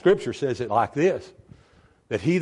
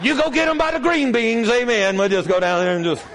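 mock excited church lady; 10 semitones higher than usual. Mock voice telling people at Walmart.

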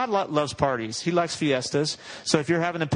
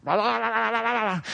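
mocking blah; 8 semitones higher than usual. Mocking complaint.

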